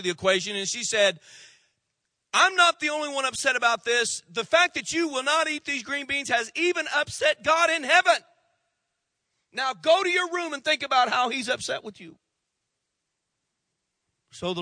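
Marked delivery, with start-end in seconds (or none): 2.3-12.2 loud, high-pitched scolding; scolding mother. Acted. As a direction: lay down the law as a fed-up mother. Exasperated mother scolds her son.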